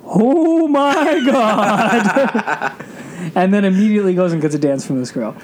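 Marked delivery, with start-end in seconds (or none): 0.0-2.8 slow, stretched-out oh my God; drawn-out amazed gasp; shocked gasp. Retold or imitated. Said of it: "looks at me and goes".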